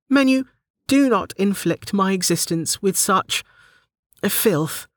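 high-pitched, indignant warning. A narrator's reading. Delta scolds the menu, high warning voice. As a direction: warn it off, falling onto the last word. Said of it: "Delta warned".

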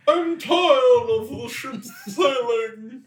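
high, slow royal voice; queen voice. Pretend. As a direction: play a haughty queen. Voicing Queen Elizabeth.

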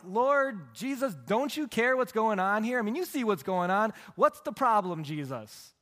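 high, loud exasperated complaint; frustrated outburst. Retold or imitated. Martha complaining to Jesus about her sister, a loud exasperated outburst. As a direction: act out the exasperated demand. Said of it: "she gets frustrated with him like".

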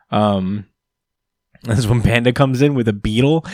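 ordinary talk

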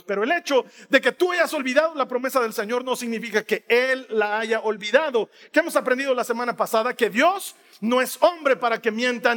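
loud, emphatic preaching, words slowed at the peaks. Acted. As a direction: drive home each promise from the pulpit.

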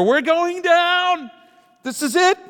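high, slow, wailing mock despair; hammed-up panic as money runs out. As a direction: wail it like the ship is sinking. Despairing reaction to tight money.

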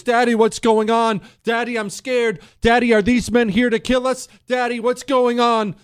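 a hint of small kids pleading with their father; frightened kids. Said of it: "Now your kids are coming down from upstairs".

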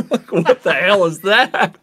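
cracking up while asking; laughing. Real, not acted. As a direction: laugh through the question. Laughing about Simpsons joke.

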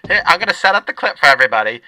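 brash lunch lady talking. Acted. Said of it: "I should just do both voices".

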